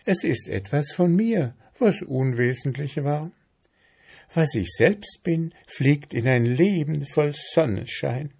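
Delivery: high, dreamy mayfly voice. A narrator's reading. Mayfly explaining herself to ant, dreamy.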